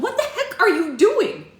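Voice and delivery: high, loud, drawn-out demand; shrieking, stretched-out outrage; horrified yell. Acted. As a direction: scream it in disbelief. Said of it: "Tangerina yelled".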